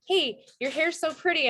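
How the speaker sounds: high-pitched mock gushing admirer; gushing compliment. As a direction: gush a compliment, imitating an admirer. Voicing gushing hair compliments.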